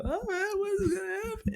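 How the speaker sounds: very high excited voice, imitated; put-on high-pitched anticipation; excited kids. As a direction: mimic giddy campers in a squeaky high pitch. Voicing excited kids on the bus.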